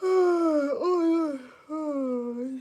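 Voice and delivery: big loud yawn stretching the oh; real yawn swallowing the words. Real, not acted. Streamer yawns mid-chat, apologizing, real yawn. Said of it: "I'm yawning so much".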